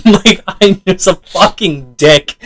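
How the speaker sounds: slow, giggly self-mockery; words drawn out through laughter; laughing admission. Real, not acted. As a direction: admit it while cracking up. Laughing at own cruel choice.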